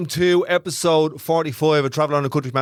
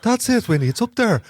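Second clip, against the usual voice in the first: high-pitched Traveller accent demo; put-on Traveller accent, up high; 8 semitones higher than usual. Shown as a demonstration. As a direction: lift the voice right up, Traveller style. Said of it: "Give us a sample of it".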